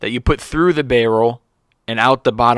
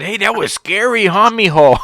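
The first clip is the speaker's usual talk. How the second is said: put-on a touch higher, sweet and babying; mock coddling manager; 8 semitones higher, 4 dB louder than usual. Friend jokingly imitates the manager calling him mijo, coddling. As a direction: soothe him like a scared little boy. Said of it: "I have no idea why he said".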